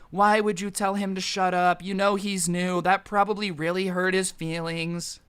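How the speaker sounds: lightly imitated scolding girl counselor; mock-concerned reproach in a put-on girly voice; disappointed girls. Girl counselors scolding boy over shut up, mock disappointed. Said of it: "all the girls look all disappointed".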